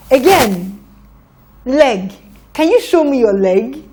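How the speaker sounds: normal talking voice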